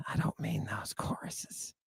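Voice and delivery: hushed, voiceless whisper; whispered aside. Real, not acted. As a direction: drop right down into a whisper. Drops to whispered aside after mock singing.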